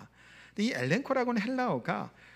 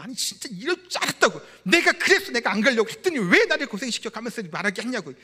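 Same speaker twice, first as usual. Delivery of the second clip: acted indignant outburst, louder and higher; angry Jonah; 4 semitones higher, 6 dB louder than usual. Jonah complains to God about being sent to Nineveh, angry. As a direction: protest hotly in Jonah's voice. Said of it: "분노를 막 내미고 있습니다".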